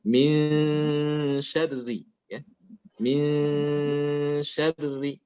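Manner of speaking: long held nasal hum on "Min" before "syarri"; ikhfa recitation. Shown as a demonstration. Teacher demonstrates ikhfa from An-Nas, slow held nasal. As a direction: draw out "Min" slowly in recitation. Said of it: "Contoh ikhfa pada surat An-Nas".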